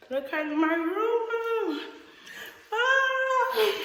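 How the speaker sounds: excited calls ringing round bare walls; big hall echo. Real, not acted. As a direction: voice echoing in an empty room. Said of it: "Sorry if I'm echoing".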